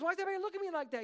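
high-pitched, rapid puzzled question; imitating someone bewildered by stares; baffled revived man. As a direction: rattle it off in a put-on high voice.

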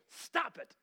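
abrupt comic shout; Bob Newhart. Retold or imitated. Counselor giving his two-word advice, sudden comic shout. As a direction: burst out high and loud. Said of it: "Bob Newhart looks at her and goes".

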